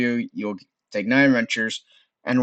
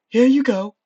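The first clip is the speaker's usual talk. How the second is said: high, loud sing-out; sorceress calling down; 12 semitones higher, 7 dB louder than usual. Acted. Sorceress tossing a reward down, high loud call.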